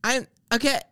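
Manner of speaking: mock her chirpy elevator phone call; phone woman. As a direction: overeager caller telling the phone she's getting in. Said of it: "she's going like".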